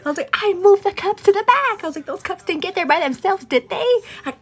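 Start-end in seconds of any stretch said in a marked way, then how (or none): 0.3-1.8 very high, loud protest; hammed-up indignant self-defence; retold bickering. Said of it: "getting real ugly". 2.1-3.9 high, sarcastic mock challenge; retold bickering. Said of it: "getting real ugly".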